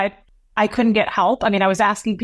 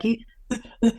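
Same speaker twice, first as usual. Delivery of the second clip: imitated gasps between words; struggling to breathe; 3 semitones higher, 4 dB quieter than usual. Calling father after the attack, imitated struggle for breath. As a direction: re-enact being too winded to talk. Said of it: "I couldn't hardly talk".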